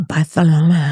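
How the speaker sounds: "Barcelona" with a th sound for the c; Castilian lisp. Shown as a demonstration. Friend echoing the trip city in Spanish style, "Barcelona" with lisped c. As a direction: say "Barcelona" as Bar-the-lona.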